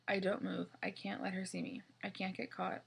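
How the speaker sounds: ordinary delivery, nothing marked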